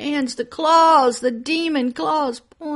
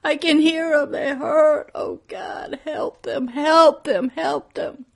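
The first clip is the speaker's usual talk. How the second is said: high, loud, choked begging; tearful pleading; 5 semitones higher, 5 dB louder than usual. Retold or imitated. Preacher answering God in the vision, weeping. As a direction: beg through tears. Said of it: "I go".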